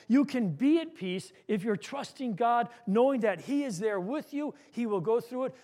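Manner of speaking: ordinary delivery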